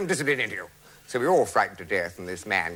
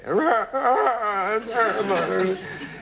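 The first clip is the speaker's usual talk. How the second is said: high laughing burst; long laugh; 12 semitones higher than usual. Real, not acted. Recalling the crying widow, laughs aloud. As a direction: laugh freely at the memory.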